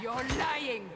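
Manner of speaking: loud, high furious shout at the prisoner; shouting she's a liar; angry accusation. Acted. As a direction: slam the accusation at her.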